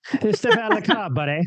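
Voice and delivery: cartoon Canadian border guard accent. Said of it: "nothing like a Canadian accent".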